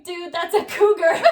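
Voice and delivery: correcting her date, laughing hard; laughing outburst. Retold or imitated. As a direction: crack up as you say it. Retold comeback, laughing.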